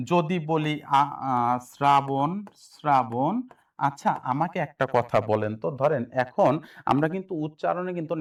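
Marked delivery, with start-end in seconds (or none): 1.7-3.4 শ্রাবণ said slowly and clearly, syllable by syllable; careful pronunciation. Shown as a demonstration. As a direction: model শ্রাবণ slowly for students to copy. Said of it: "একটু খেয়াল করেন ভাইয়া". (শ্রাবণ ধীরে স্পষ্ট উচ্চারণে)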